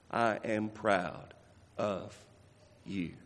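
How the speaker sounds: slow, low, soft loving father; God the Father speaking gently to his son; tender heavenly voice. Acted. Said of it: "a voice from heaven said".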